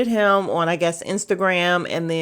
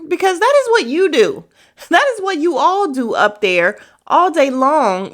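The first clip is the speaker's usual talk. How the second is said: heated rant; 8 semitones higher, 7 dB louder than usual. Real, not acted. Heated rant after outburst.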